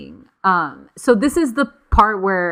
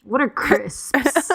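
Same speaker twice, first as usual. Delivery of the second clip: mock puzzled; 7 semitones higher, much slower than usual. Mocking British snack names.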